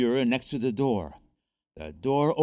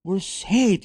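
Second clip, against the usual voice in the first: high, loud exclamation of relief; delighted, raised-voice cry; happy relief; 7 semitones higher, 6 dB louder than usual. A narrator's reading. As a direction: burst out happily. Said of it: "happily clapped his hands".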